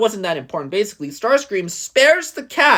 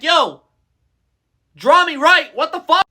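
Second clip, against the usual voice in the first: a touch of offended, louder and higher; mock insulted; 6 semitones higher, 5 dB louder than usual. Starscream offended by a human's sketch, mock insulted outburst. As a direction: play insulted over a bad sketch. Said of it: "Starscream's more insulted".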